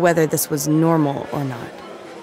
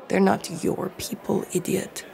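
softer muttered aside; under her breath; 3 semitones higher, 6 dB quieter than usual. Acted. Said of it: "Aggie said under her breath".

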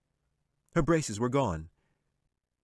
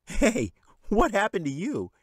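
put-on high boy voice, louder, amused; teasing boy; 13 semitones higher, 5 dB louder than usual. A narrator's reading. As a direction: tease her like a brother. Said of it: "he said and laughed".

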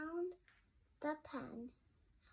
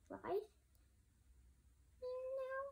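much higher, hopeful little cub; eager girl cub; 9 semitones higher, 7 dB quieter than usual. Acted. Sister cub asking Farmer Ben to ride, eager and high.

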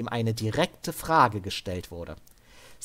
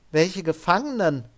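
higher, jittery answer; nervous; 6 semitones higher, 4 dB louder than usual. Acted. Devin nervously answering the interrogators, higher pitched. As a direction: play dumb but scared. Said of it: "antwortete Devin nervös".

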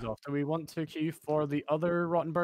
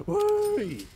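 excited whee; 7 dB louder than usual. Real, not acted. Jumping high in game.

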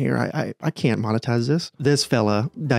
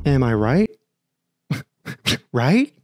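ordinary talk

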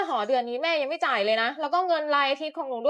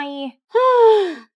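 big, loud exasperated sigh; 4 semitones higher, 13 dB louder than usual. Acted. Mother drained by her daughter's requests sighs loudly. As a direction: heave it out, fed up.

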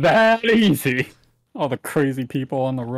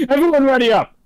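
loud; 9 semitones higher, 7 dB louder than usual. Real, not acted. Calling everyone to ready.

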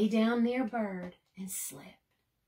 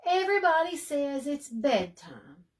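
whiny complaint; 5 semitones higher, 5 dB louder than usual. Acted. Bird complaining.

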